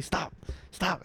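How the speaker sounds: soft, offhand stop; casual stop. Retold or imitated. Retelling a scene of a touched thigh, casual soft stop. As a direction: brush it off quietly, barely objecting. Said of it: "like, all casually".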